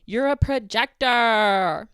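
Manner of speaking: drawn-out furious shout; loud shout. Real, not acted. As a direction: yell it right at her. Shouting the accusation at Madison.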